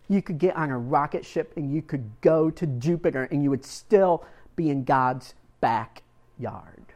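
normal talking voice

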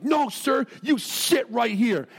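freaking-out son. Retold or imitated. Son ordering dad to stay in the trailer, imitated angry shout. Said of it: "freaking out at Dad".

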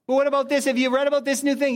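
hammed-up alarmed chatter; mock panicky chatter. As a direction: rattle it off like a news-obsessed worrier. Voicing an anxious objector.

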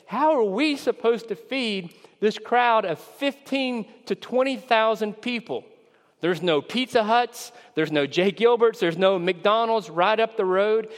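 high-pitched, mildly exasperated objection; incredulous protest. Acted. Disciples protesting to Jesus about feeding the crowd, incredulous.

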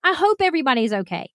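high-pitched mock panicked yelling; retold freak-out. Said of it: "I start freaking out and yelling".

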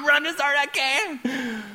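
high, strained, drawn-out yell; frantic mock scream. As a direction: yell comically as if sprinting flat out. Acts sprinting on too-fast treadmill.

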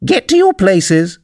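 high, drawn-out royal yell; thunderous shout. A narrator's reading. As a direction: order everyone about like a queen. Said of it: "shouted in a voice of thunder".

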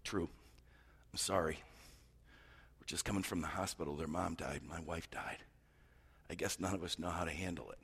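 quietly, like a man fresh from the hospital; grieving father. Retold or imitated. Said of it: "the dad responded and said".

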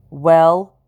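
a loud impatient rising question; demanding. A narrator's reading. Mistress demanding the cook top the offer, loud. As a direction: press the silent cook for an answer. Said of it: "Madam Lockton demanded".

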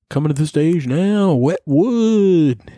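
high, loud hype announcer; club announcer. Pretend. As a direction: introduce the act to a crowd. Announcing stripper name.

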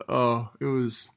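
normal talking voice

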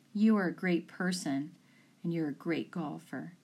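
ordinary talk, nothing marked

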